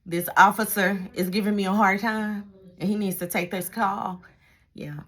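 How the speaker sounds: ordinary delivery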